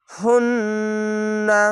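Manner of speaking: very slow হুন্না with a long held nasal ন্ন; nasal ghunna. Shown as a demonstration. Teacher shows the obligatory ghunna on হুন্না. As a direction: hum through the ন্ন of হুন্না slowly. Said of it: "এখানে ওয়াজিব গুন্না".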